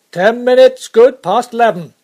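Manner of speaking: loud, high call back; guard hollering the time to the driver; shouted reply. Acted.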